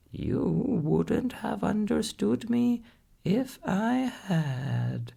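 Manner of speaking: high, squawky-pitched parrot voice; a clever old parrot explaining patiently. A narrator's reading. Parrot Polynesia explaining to the doctor, high parrot voice.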